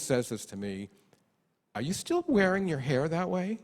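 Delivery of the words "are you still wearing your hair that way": mocking the mother. Mother's critical remark, acted.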